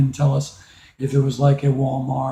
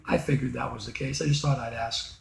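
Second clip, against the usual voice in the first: much softer resigned words; resigned quiet; 4 semitones lower, 15 dB quieter than usual. Acted. Disappointed boy telling mom he figured, much softer. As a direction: quietly let it go.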